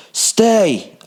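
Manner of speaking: high, loud one-word command; barked order. A narrator's reading. Killer ordering his captive to stay, loud barked command. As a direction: bark it like ordering a dog. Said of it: "I ordered".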